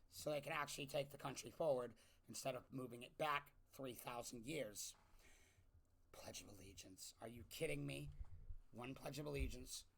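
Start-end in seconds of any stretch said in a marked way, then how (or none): 6.1-7.1 unvoiced incredulous whisper; whispered disbelief. Real, not acted. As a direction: breathe it in disbelief. Incredulous aside.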